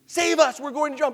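high-pitched plea for rescue; mock screaming. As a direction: cry out as if going under. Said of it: "that they're screaming".

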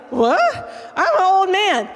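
a mock incredulous old priest; Zechariah's disbelief. Zechariah answering the angel, mock disbelieving old man. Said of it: "and he's like".

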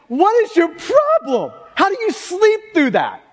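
high, exasperated mock disbelief; acting out an incredulous, sarcastic captain. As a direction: pitch it up and stretch it, appalled. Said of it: "You see this sarcastic bent".

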